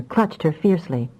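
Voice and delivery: usual speaking voice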